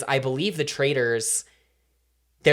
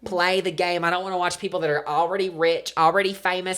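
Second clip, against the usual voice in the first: exasperated country rant; country accent; 3 semitones higher than usual. Pretend. Ranting to co-host about celebrities, country accent. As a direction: complain with a Southern twang. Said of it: "impressed at how good that was".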